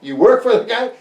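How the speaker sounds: very high, loud disbelief; astonished question. Retold or imitated. Speaker to radar tech, astonished high question. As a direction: gasp out the question amazed.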